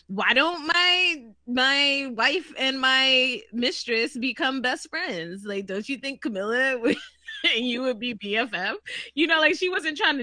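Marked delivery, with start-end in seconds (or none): 0.1-8.8 mock Charles. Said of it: "Charles was trying to be like".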